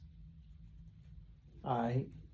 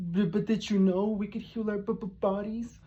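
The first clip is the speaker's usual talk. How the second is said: higher, stammering mock voice; higher, stammering imitation of a nervous man pleading with professors; mocking a timid man; 6 semitones higher than usual. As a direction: stutter it like a nervous asker. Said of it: "He's about to ask people".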